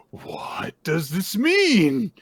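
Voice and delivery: slow, high, wide-eyed puzzled voice; hammed-up innocent confusion; bewildered child. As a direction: ask it like a naive creature. Jura puzzled by crying.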